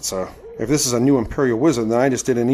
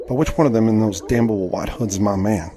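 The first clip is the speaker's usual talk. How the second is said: usual speaking voice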